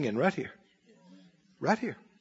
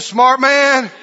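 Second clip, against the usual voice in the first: "Smart man" said higher and louder; repeated louder; 7 semitones higher, 9 dB louder than usual. Shown as a demonstration. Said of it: "I said".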